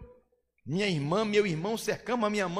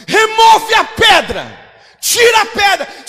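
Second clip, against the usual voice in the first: huge, higher shout, slowed on the first words; commanding shout; 12 semitones higher, 16 dB louder, slower than usual. Acted. Preacher voicing Jesus at the tomb, commanding shout. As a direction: bellow the command to the crowd.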